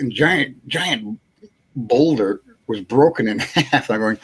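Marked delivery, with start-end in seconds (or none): none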